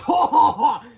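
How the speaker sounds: high, loud acted ho-ho-ho; big stagey cackle; mocking laugh. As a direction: belt out a theatrical laugh. Villain teacher laughs.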